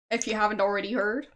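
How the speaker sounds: ordinary delivery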